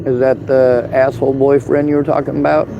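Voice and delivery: ordinary talk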